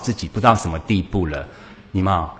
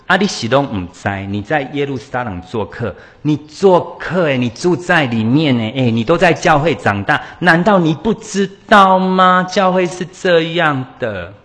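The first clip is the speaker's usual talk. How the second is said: challenging retort; 6 semitones higher, 5 dB louder than usual. Retold or imitated. Church member challenging a newcomer.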